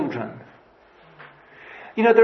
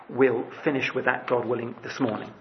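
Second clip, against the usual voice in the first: usual speaking voice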